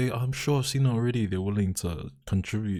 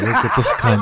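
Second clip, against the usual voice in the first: laughing mock jab; 9 dB louder than usual. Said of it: "he's like".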